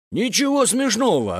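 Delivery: high-pitched hurt protest; offended outcry. A narrator's reading. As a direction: cry out, stung by the laughter. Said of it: "обиженно выкрикнул Зер". (обиженно выкрикивает)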